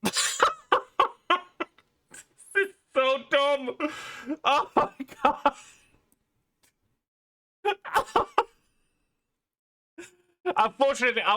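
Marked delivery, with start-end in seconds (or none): 0.0-8.3 high, squeezed out through laughing; real helpless laughter. Real, not acted. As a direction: barely get the words out for laughing. Helpless laughter at absurd show.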